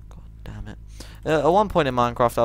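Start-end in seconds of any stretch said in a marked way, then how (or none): 0.1-0.8 whispered curse under the breath. Real, not acted.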